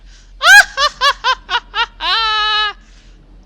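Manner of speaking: high, loud cackling laugh that breaks into a cry; maniacal villain laugh. Acted. Rainbow Dash gloating over captured ponies, maniacal cackle. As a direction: throw your head back and cackle madly. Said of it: "laughed maniacally".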